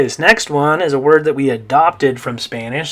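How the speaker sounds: ordinary delivery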